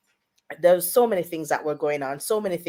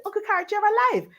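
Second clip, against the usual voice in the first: retold shocked exclamation, much higher and fast; astonished cry; 14 semitones higher, much faster than usual. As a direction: blurt it like seeing a ghost alive. Retold dream, daughter sees dead uncle.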